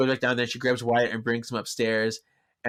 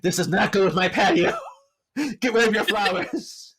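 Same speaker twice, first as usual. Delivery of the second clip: higher, louder bossy voice; snooty demand; 9 semitones higher, 3 dB louder than usual. Retold or imitated. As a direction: order the neighbor around haughtily. Sheila berating her neighbor.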